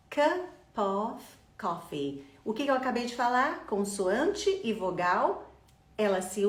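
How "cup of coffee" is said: "Cup of coffee" slow, the p running into "of"; slow linked cup of coffee. Shown as a demonstration. Teacher modelling the linked phrase for students, slowly. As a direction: link "Cup of" as one word, drawn out. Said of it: "eu digo cup of coffee".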